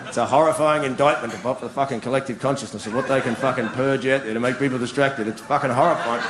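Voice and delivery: low, level rant; grave indictment. Real, not acted. Comic condemning what TV purges out, grave monotone. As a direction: pronounce it like a damning verdict, low and steady. Sudden mock-solemn verdict on television.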